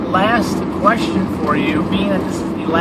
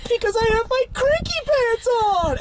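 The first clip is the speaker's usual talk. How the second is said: cute cranky voice. Retold or imitated. Wife's cute cranky reply quoted.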